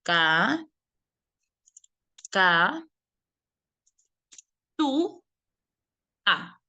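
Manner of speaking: "Ca, ca, tu, a" sounded out piece by piece for a class; syllable by syllable. Shown as a demonstration. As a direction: break the word into "Ca", "ca", "tu", "a", one slow beat each. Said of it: "cómo la vas a silabear".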